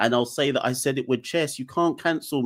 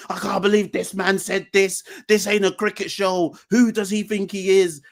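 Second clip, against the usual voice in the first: higher, mock indignant viewer voice; mock outraged viewer; 6 semitones higher than usual. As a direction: play the shocked viewer, tongue in cheek.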